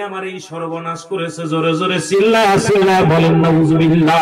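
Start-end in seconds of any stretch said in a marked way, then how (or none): 1.5-4.2 loud, forceful preacher's call; rally the crowd to shout back; rousing congregational call. Real, not acted. Urging congregation to shout responses. (শ্রোতাদের উদ্দেশে জোরালো হাঁক)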